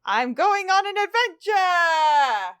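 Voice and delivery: high, slow, loud hobbit voice; quoting a hobbit with glee, high and slow; Bilbo Baggins. Retold or imitated. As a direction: chirp it like an excited hobbit setting out. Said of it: "To quote Bilbo Baggins".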